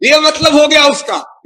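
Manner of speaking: ordinary talk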